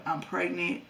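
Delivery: ordinary delivery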